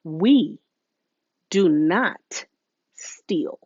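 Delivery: slow, word-by-word firm statement; mother calmly setting the family rule; firm correction. Retold or imitated. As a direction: spell it out with calm authority. Mom correcting daughter firmly.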